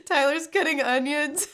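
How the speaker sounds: high, teary joke; tearful laughing. Real, not acted. Streamer blaming onions for real tears, laughing tearfully. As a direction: joke through the tears and giggles.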